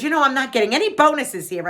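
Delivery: mildly aggravated retold grumble; imitating aggravated friend. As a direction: gripe like an aggravated gambler. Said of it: "why she's a little aggravated".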